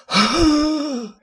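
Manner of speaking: sharp high gasp; elf gasp. Shown as a demonstration. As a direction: gasp like a startled creature. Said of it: "You just gotta go like".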